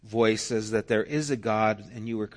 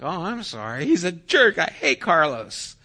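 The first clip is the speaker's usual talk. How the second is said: higher, louder mock outrage at the boy; sympathetic parent; 7 semitones higher, 5 dB louder than usual. Parent comforting the daughter with hammed-up anger. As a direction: side with her theatrically.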